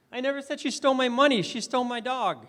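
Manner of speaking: high, loud run landing on "money", contrasted with "dog"; stress on MONEY. Shown as a demonstration. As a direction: push up and punch "money", then "dog". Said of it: "I emphasized different words".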